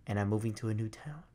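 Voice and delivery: breathy whisper of the letter's last line. Retold or imitated. Reading the neighbor's goodbye letter, whispered. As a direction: let the bad news slip out in a whisper.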